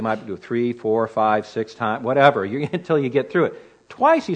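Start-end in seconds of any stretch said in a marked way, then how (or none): none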